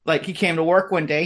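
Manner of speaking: ordinary talk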